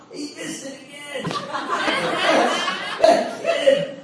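acted high, wheezing laughter through the words; having a ball. God watching Steve miss again, having a ball. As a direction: laugh so hard the words squeak out. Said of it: "he's probably having a ball".

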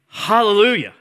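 high, loud ringing shout; crying out hallelujah. Acted. Pastor voicing heavenly multitude to congregation, shouting. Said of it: "loud voice of a great multitude in heaven crying out".

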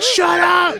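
high-pitched shouted complaint; cats yelling. Acted. Cats fed up with owner's chatter, shouting. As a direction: yell it like a fed-up cat. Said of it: "my cats meow at me".

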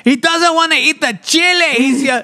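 high, slow mock yell; hammed-up scolding relative; shrill relative. Relative yelling at kid's tormentors, high. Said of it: "they'd be like".

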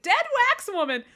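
high, loud blurt of shock; mock horror. Retelling her horror at the wax woman with a high, loud blurt. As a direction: yelp it at the fake corpse.

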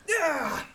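high, loud attack yell; battle cry. Acted. Enemy swinging a sword, high battle yell.

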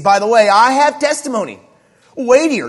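"testimony" and "weightier" driven out high and very loud; pause, then punch "weightier" high and loud; emphatic declaration. Acted. Jesus pressing his claim on listeners, forcefully emphatic "weightier". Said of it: "all the language here in verse thirty-six is emphatic".

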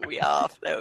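very deep, soft monster-clown voice. Acted. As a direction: drop to a menacing bottom-register rumble. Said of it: "the it clown".

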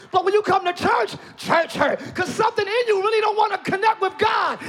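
loud, rising preacher's cry; shouting sermon climax to the congregation; full-force preaching. Real, not acted. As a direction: preach it with everything you've got.

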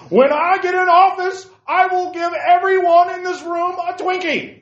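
high, loud, slow stump speech; booming mock campaign rally voice; mock politician. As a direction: ham up a campaign promise. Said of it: "political candidates".

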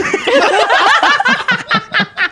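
high tipsy giggle. Real, not acted. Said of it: "I'm so tipsy right now".

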